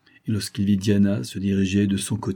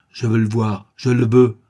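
building to a loud insistent demand; stubborn insisting, rising in volume; 2 semitones higher, 7 dB louder than usual. A narrator's reading. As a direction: hammer home the demand, louder at the end. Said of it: "insistait Favreau".

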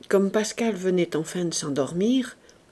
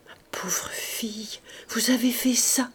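weak whisper; 4 semitones higher, 3 dB quieter than usual. Acted. Dying master touched by maid.

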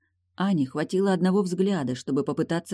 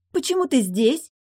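put-on high, louder, startled girlish question; edgy, raised-pitch unease; slightly nervous; 10 semitones higher, 5 dB louder than usual. A narrator's reading. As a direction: ask as if caught out by father. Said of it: "слегка нервно спросила она".

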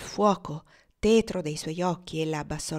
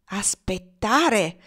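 loud, drawn-out incredulous echo; indignant exclamation; 2 semitones higher, 7 dB louder, much slower than usual. A narrator's reading. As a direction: throw the word back, stretching it out. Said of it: "esclamò Cassie". (esclamazione indignata, forte e allungata)

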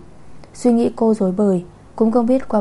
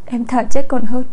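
higher and much louder, crying out in despair; desperate outcry; 4 semitones higher, 9 dB louder than usual. Acted. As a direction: fling it out: she'd rather die. Despairing vow against forced marriage. (giọng cao vút, gào lên tuyệt vọng)

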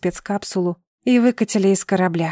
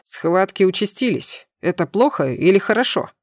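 ordinary delivery, nothing marked

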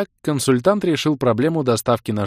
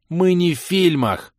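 snapped retort; 8 semitones higher, 4 dB louder, slower than usual. Acted. Snapping at heroine.

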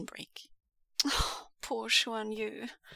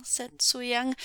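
usual speaking voice

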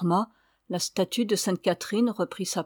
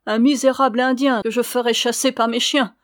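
loud, raised scorn; contemptuous threat; 4 semitones higher, 7 dB louder than usual. A narrator's reading. Signora threatening to set dogs on Alessandro, contemptuous. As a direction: spit contempt at the suitor.

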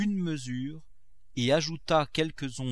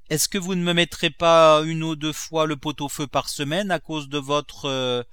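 higher, louder petition; lightly voiced servant Nanon; 5 semitones higher, 10 dB louder than usual. Servant asking for stew more often, lightly acted insistence. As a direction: press the master with a request.

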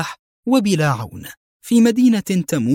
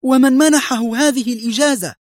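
high, furious demand; acted high-pitched outrage; angry shout; 12 semitones higher, 4 dB louder than usual. A narrator's reading. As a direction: shout the question in her face. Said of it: "صاحت في وجهها بغضب".

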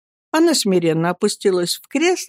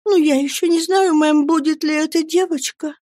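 put-on higher, guileless young mother; naive; 9 semitones higher than usual. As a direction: say it innocently to the aunt. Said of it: "простодушно сказала матушка". (простодушно, высоким голосом)

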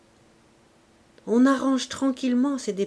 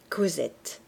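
lower, softer echo of the name; repeated softly; 5 semitones lower, 5 dB quieter than usual. Shown as a demonstration. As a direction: say the name again under your breath, lower. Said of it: "Lisez Euphrasie". (répéter «Cosette» plus bas et plus doucement, comme un écho)

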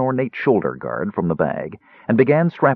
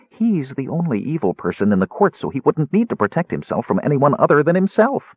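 higher, matter-of-fact Kender; chatty Kender; 6 semitones higher than usual. Acted. Tass explains his logic to Caramon, chatty Kender. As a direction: reason it out like an eager Kender.

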